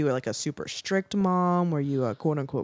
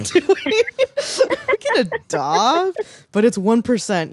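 laughing plea; 10 semitones higher, 6 dB louder than usual. Real, not acted.

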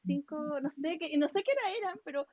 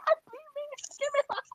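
high and louder eager plea; excited; 13 semitones higher, 4 dB louder than usual. Retold or imitated. Asking dream friend to spill the secret, high and eager. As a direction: squeal it out, dying to know. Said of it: "Yo estaba emocionada".

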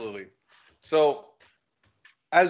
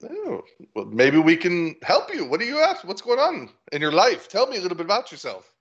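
higher-pitched, chatty little halfling; lively, lilting character voice, pitched up; friendly halfling; 5 semitones higher than usual. Acted. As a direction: catch up eagerly with a long-lost friend. Plays the halfling.

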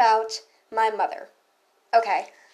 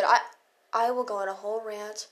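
ordinary talk, nothing marked

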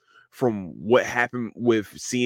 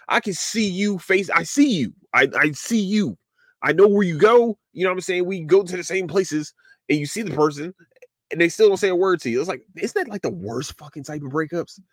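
higher-pitched mock-hurt complaining; exasperated breakup rant; 6 semitones higher than usual. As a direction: whine like someone who got ghosted. Acts out hurt ghosted ex.

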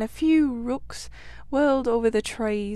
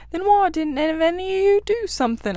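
higher, louder, exasperated challenge; indignant demand; 7 semitones higher, 4 dB louder than usual. Acted. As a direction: scold the rabbit for doing nothing. Otter scolds the scared rabbit.

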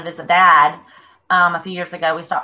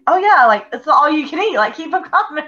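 eager; 8 semitones higher than usual. Retold or imitated. Retelling eager order for more shrimp.

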